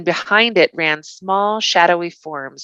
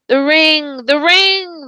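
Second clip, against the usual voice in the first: high, loud, grasping wraith cry; deadly voices; 10 semitones higher, 3 dB louder, slower than usual. Acted. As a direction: crave the ring out loud. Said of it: "they cried with deadly voices".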